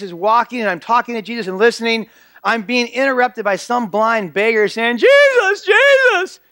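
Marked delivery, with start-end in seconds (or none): 5.0-6.4 high, loud cry for attention; frantic yelling. Acted. Blind beggar interrupting Jesus from the background, frantic yelling. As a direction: yell the name out frantically, pitched way up. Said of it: "He was yelling loud".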